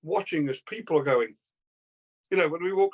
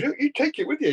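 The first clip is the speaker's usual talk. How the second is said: high-pitched acted quote; retold eager offer; 11 semitones higher, 3 dB louder than usual. [name] fan handing over his magazine, eager.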